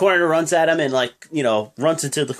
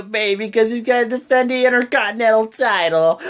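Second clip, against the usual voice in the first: hammed-up baby crying; mock crying; 5 semitones higher than usual. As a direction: fake blubbering sob.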